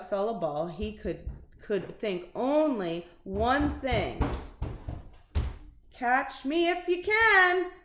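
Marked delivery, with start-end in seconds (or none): 5.9-7.7 high, loud, slow teasing call; playful taunt. A narrator's reading. Dog taunting everyone to catch him. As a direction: tease the chasers.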